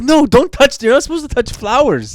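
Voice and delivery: high, loud mock scold; high, loud imitation of the girlfriend scolding him. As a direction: snap a warning at him. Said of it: "she's like".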